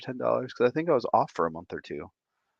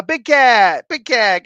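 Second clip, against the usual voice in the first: slow, loud, higher hype call of Big Cat; announcer call; 11 semitones higher, 11 dB louder, slower than usual. Acted. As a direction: announce the name like a ring announcer.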